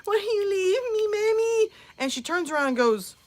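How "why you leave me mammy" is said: put-on high crying wail of a lost kid; hammed-up howl for mommy; mock wailing child. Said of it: "I'm screaming through the store".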